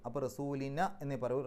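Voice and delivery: ordinary talk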